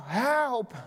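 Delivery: high-pitched mock cry for help; acted yell for help. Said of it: "he yelled out".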